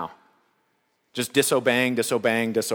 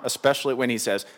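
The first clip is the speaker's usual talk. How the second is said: ordinary talk, nothing marked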